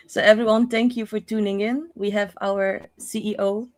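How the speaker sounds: put-on light Dutch accent. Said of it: "I can do the Dutch accent from now on".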